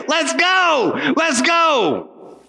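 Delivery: rally the students, fired up; excited cheer. Real, not acted. Preacher cheers the story.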